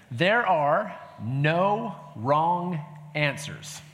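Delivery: very slow, each word spaced out; slow reassuring. Real, not acted. Pastor reassuring the congregation, slowly. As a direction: stretch it out to calm the room. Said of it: "here's the wonderful thing".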